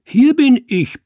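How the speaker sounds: very high, loud mimicking bellow; roaring back. A narrator's reading. Cat imitating the man's roar, bellowing. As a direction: bellow it just like your opponent. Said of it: "brüllte ich, wie er brüllte".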